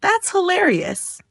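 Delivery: high, amused disbelief; amused surprise. Shown as a demonstration. Coworker wore mismatched socks, amused surprise. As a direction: react with a smiling you're kidding. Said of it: "You smile and say".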